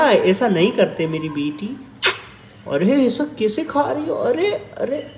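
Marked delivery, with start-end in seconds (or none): 2.7-5.1 very high gentle scolding; mock scolding kitten. Real, not acted. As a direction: fuss over the kitten. (बिल्ली को ऊँची आवाज़ में प्यार से डांटना)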